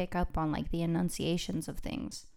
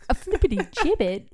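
higher-pitched nonsense British; British accents blended; 4 semitones higher, 6 dB louder than usual. Acted. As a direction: babble in a mock British accent. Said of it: "like, British English accents".

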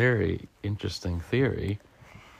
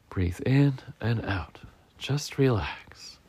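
soothing relaxation voice; 2 semitones higher, slower than usual. Acted. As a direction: draw it out gently to calm. Squirrel does yoga to relax.

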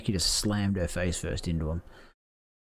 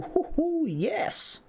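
thrilled cheer; much slower than usual. Real, not acted. Fan cheering a meteora through the table, whooping. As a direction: whoop with real delight.

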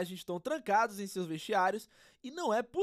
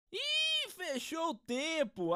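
surprised exclamation; 7 semitones higher, much slower than usual. Acted. Commentator reacts to chaos.

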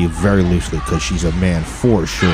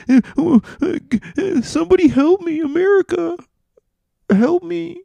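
very high, strained squeal; mock cry for help. Pinned victim calls out for help, high mock-helpless squeal. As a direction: squeak it out as if someone is sitting on you.

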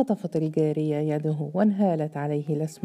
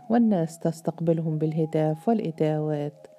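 ordinary delivery

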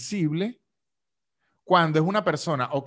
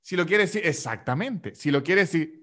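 usual speaking voice

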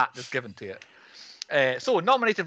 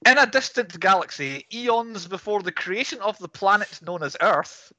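grand booming space narration; 3 semitones higher, 3 dB louder than usual. Pretend. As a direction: read it like an epic film crawl. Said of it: "He's reading the scroll at the start".

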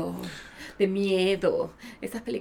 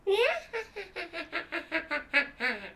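put-on high cackling old hag laugh; witch cackle; 8 semitones higher than usual. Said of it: "Es mi risa de bruja".

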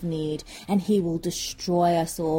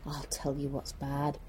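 low, hushed tease; ominous setup; 6 semitones lower, 11 dB quieter than usual. Acted. Peddler baiting the villagers with news, low and hushed. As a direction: drop right down before the big reveal. Peddler setting up his big reveal.

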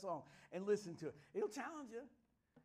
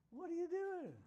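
mock surprised Paul; 10 semitones higher than usual. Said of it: "he goes".

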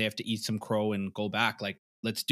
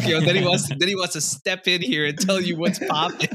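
teasing; 5 semitones higher, 5 dB louder than usual. Real, not acted. Teasing producer's fitness pride.

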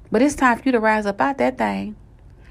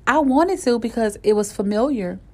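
ordinary talk

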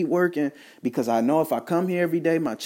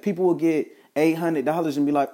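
ordinary talk, nothing marked

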